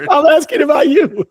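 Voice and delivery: high-pitched, loud insistence; emphatic insistence. Real, not acted. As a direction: insist loudly, pitch jumping up. Playful exasperated clarification.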